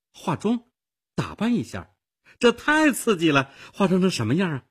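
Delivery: high-pitched, thrilled exclamation; eager, high-voiced excitement; excited. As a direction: let the pitch leap with delight at the idea. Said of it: "艾尔莎兴奋地说".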